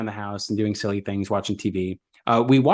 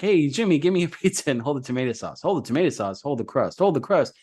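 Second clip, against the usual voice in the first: string cheese commercial; 3 semitones higher than usual. Retold or imitated. Podcaster re-enacting a cheese commercial for a co-host, commercial impression. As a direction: act out a remembered TV commercial. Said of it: "reminds me of the Polly-O string cheese commercial".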